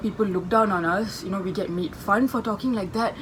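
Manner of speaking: normal talking voice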